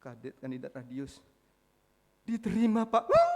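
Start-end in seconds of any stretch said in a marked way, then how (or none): 2.3-3.3 loud, high imitation of tearful joy; imitating a big man's crying outburst; mock crying shout. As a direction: wail it out, hammed-up, breaking into a high whoop. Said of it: "dia nangis".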